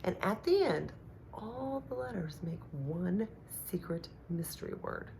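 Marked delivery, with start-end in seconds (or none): 1.3-5.1 secret hush. Acted. Teacher hushing to tease kindergartners about the mystery word. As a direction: drop to a hushed, secretive voice.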